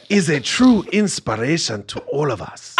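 a light Wakandan king voice. Acted.

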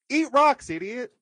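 high, loud snap of an insult; mock insult. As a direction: fling it with scorn. Insulting the meddling woman.

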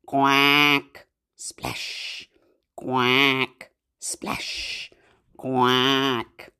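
low quack, whispered splash; quacking duck. Pretend. Teacher voices the paddling duck. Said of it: "Duck says".

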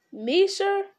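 high, rising question of disbelief; astonished cry. A narrator's reading. Sam hears he shall go with Frodo, astonished cry. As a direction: cry out high, unable to believe your luck. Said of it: "cried Sam".

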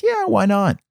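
light, high-pitched imitation of her easy yes; breezy high quote of a dog owner; her casual yes. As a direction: pitch it up for a breezy, shrugging agreement. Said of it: "She says".

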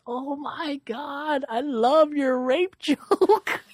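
high, slow gushing mom voice; gushing older woman. Retold or imitated. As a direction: coo it like a delighted older lady. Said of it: "She goes".

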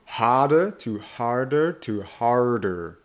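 "harder" three times, from no R to heavy R; R getting harder. Shown as a demonstration. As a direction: slowly step up the R in each "harder". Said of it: "make it sound even harder".

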